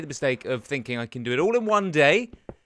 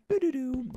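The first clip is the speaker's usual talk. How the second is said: high sung "boo-doo-doo" alert; imitated computer chime; 10 semitones higher than usual. YouTuber imitating the weird computer alert, as a high chime. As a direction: mimic a computer notification jingle. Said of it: "it done the whole".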